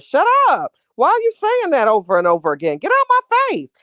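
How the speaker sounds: loud, high-pitched acted outburst; angry telling-off. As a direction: yell at him like you've had enough. Acting out telling off annoying man.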